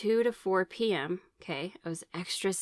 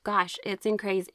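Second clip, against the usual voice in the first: normal talking voice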